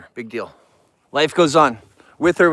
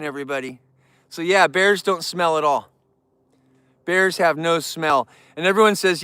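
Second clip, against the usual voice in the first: ordinary talk, nothing marked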